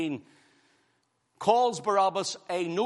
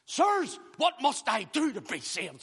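high, urgent plea of a frightened jailer; pleading jailer; 8 semitones higher than usual. Acted. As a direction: cry out like the jailer begging. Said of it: "said the jailer".

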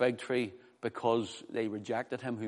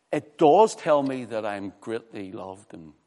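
usual speaking voice

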